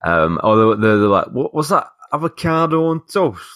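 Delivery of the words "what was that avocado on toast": high-pitched mock disbelief; high, incredulous mimicry; mocking northern transplants. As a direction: parody a baffled newcomer, pitched well up. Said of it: "these are the people that come".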